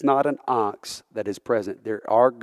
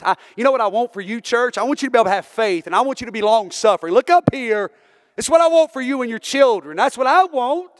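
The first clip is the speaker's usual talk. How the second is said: higher and fast, pleading with the church; rising preaching; 10 semitones higher, 4 dB louder than usual. Real, not acted. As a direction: lift it up and press the point home. Preacher lifts voice again to church.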